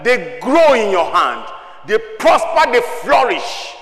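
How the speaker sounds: loud, emphatic exhortation; forceful preacher's declarations, key words stretched; preaching at full force. Real, not acted. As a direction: drive each phrase home from the pulpit.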